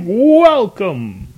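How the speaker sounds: big high ringmaster welcome; circus performer. Shown as a demonstration. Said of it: "like a circus performer".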